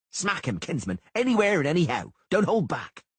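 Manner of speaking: high, loud battle urging; yelled urging. A narrator's reading. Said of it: "Sheepbagger yelled".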